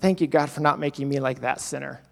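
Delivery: a proud Pharisee sneering at the sinner; smug Pharisee. Acted. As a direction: look down your nose and thank God. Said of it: "The Pharisee looks back at him and says".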